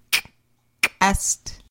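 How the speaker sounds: "kast" with a hard popped k; hard glottal k. Shown as a demonstration. Teacher modelling glottal K for learners, hard k. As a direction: snap the k in "kast". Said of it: "Glottal K is pronounced as a hard K".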